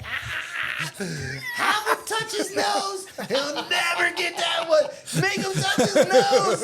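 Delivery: high, loud, slow cackling taunt; laughing taunt. Retold or imitated. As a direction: shout it through laughter like a drunk heckler. Drunk buddy mocks cops, laughing.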